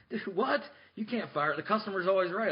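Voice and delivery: shocked client. Retold or imitated. Client protests being fired.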